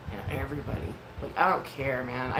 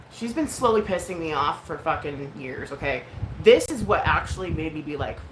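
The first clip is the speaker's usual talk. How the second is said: ordinary talk, nothing marked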